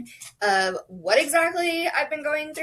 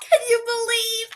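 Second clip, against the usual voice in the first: much higher, excited shout; thrilled shout; 10 semitones higher than usual. Real, not acted. Vlogger asking viewers to believe her weight loss, thrilled shout. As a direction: shout it out in delight.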